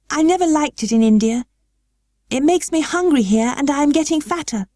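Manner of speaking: put-on high girlish voice, a touch uneasy; a small girl trying not to let her voice shake; girl Mary. A narrator's reading. Mary answering her guardian, a high girlish voice. Mary's voice in read story.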